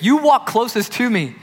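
loud, acted indignation at close friends; frustrated. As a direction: reproach them, voice lifting. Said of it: "a spillover of his frustration with the disciples".

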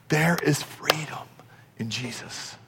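fading down to a whisper. Real, not acted. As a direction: drop it to a hushed whisper. Repeated freedom, fading to whisper.

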